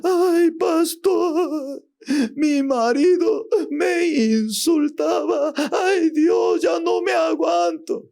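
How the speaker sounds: very high, slow, crying lament; crying young wife. Retold or imitated. Young wife crying to the pastor about her husband, high and sobbing. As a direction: wail to the pastor in tears. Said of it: "estaba llorando".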